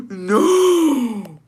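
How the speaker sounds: high, loud drawn-out mock horror; scandalized. Reacting to grandma's gorditas remark, scandalized cry.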